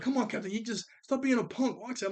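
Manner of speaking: high-pitched mock peer pressure; goading friends. Imitates friends pressuring the speaker.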